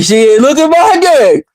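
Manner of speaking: lightly voiced boastful dude comparing sizes; bragging guys. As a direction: imitate guys showing off and comparing. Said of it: "They like".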